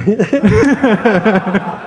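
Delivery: high burst of laughing; laughing at the punchline; real laughter. Real, not acted. Speaker finishing the Kevin story for the audience, high laughter.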